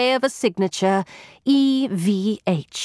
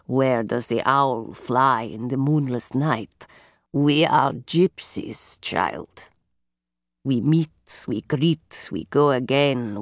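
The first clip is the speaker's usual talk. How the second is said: low softly rasping voice; 9 semitones lower, 3 dB quieter than usual. Acted. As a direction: speak like a solemn fortune teller. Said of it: "her low and softly rasping voice".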